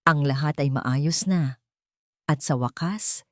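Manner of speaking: usual speaking voice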